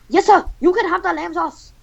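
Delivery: high, eager caricature; high-pitched deference to an idol; Gordon Ramsay. Acted. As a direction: agree eagerly in a high put-on voice. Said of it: "then Gordon Ramsay, who".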